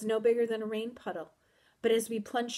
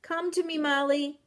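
high, luring call; coaxing, raised call; 7 semitones higher, 5 dB louder than usual. A narrator's reading. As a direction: beckon from far away. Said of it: "My dog called again".

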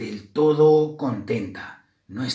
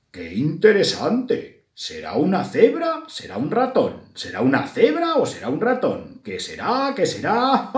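higher, sing-song wondering voice; puzzled zebra; 6 semitones higher than usual. A narrator's reading. A zebra wonders if it is zebra or mouse, intrigued. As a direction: muse aloud, swinging up and down on each question.

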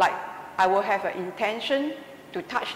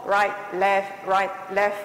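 evenly beaten drill count; marching count; 4 dB louder than usual. Shown as a demonstration. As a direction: call out the steps in march rhythm. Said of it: "you have done marching before".

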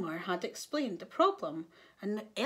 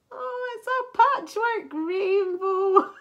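high, loud, slow drawn-out exclamation; gleeful Elmer; 10 semitones higher, 8 dB louder, slower than usual. A narrator's reading. Elmer jokes about the rainbow's new look, gleeful.

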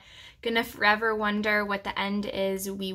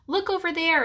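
plain reading voice

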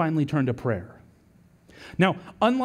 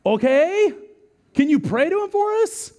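higher, eager plea; frightened sailors begging Jonah, higher and eager; pleading sailors; 10 semitones higher, 4 dB louder than usual. Retold or imitated. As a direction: beg him to pray, half-mocking. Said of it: "they're like".

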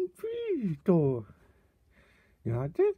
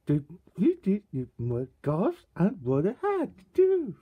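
usual speaking voice

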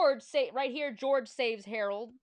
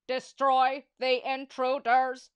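slow loud robot chant; 3 semitones higher, 9 dB louder, slower than usual. Acted. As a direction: drone it like a marching robot. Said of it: "said the robots".

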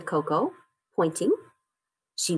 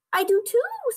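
very high little cub voice; Baby Bear; 4 dB louder than usual. A narrator's reading. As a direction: pipe up like a tiny animal. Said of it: "said Baby Bear".

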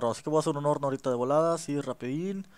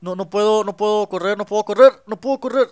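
scared panic; 5 semitones higher, 9 dB louder than usual. Real, not acted. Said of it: "Tengo miedo, tengo miedo".

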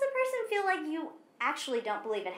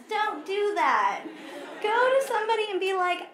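laughing; 7 semitones higher, 6 dB louder than usual. Real, not acted. Laughing warning after mock quote.

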